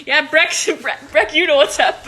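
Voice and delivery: real amused laughter through the words; laughing. Real, not acted. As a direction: break into laughter while calling out. Laughing aside to a person named.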